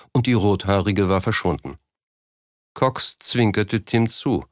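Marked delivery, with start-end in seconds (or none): none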